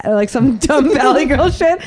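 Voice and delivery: usual speaking voice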